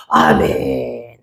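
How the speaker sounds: very low, very slow amen; calm amen. Retold or imitated. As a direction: let it settle into peace. Answered prayer, calm amen.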